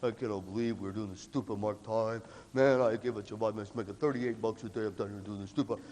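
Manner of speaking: lightly voiced low, complaining senior; grumbling seniors. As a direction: gripe lightly like a fed-up upperclassman. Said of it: "two seniors down here going".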